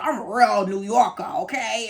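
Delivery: imitated proud New Yorker; thick New York accent. Supplier insisting he's a New Yorker, mock New York accent. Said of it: "he had a real thick accent".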